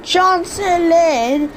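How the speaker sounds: slow, rising mock British accent; bad British accent. Shown as a demonstration. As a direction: overdo a British station call, "Chancery" as /tʃɑ̃nsel/. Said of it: "I can't speak in a British accent".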